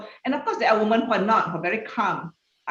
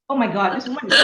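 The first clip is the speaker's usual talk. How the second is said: mock biased colleague; 3 semitones higher, 5 dB louder than usual. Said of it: "I'm gonna use a woman".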